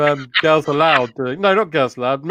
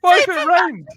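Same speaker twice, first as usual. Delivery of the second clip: high-pitched question through laughter; incredulous laughing; 12 semitones higher than usual. Real, not acted. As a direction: ask it helpless with laughter. Incredulous question amid shared laughter.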